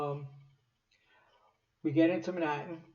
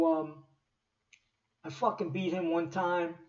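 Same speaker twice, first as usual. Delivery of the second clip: ordinary talk, nothing marked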